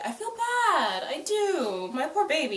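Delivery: high, soft pity; pitying coo. Real, not acted. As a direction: coo over a hurt character. Pitying a character.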